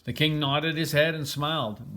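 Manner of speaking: plain reading voice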